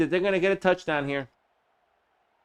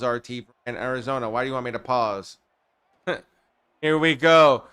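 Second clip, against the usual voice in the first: usual speaking voice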